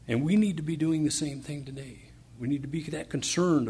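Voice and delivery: fired up about souls left unsaved; preaching at full force. Real, not acted.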